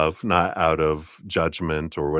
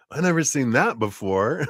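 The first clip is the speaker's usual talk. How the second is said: amazed; 8 semitones higher than usual. Retold or imitated. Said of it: "and then we're like".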